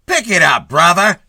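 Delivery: loud, high barking cop; gruff shouting policeman; snapped order. A narrator's reading. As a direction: bark it at him like a traffic cop. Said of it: "snapped a cop".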